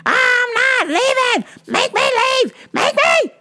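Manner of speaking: high-pitched mock scream of a woman refusing to leave, slowed; defiant screaming woman. Arrested woman yelling at the police, high mock shriek. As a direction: shriek it like she's digging in her heels.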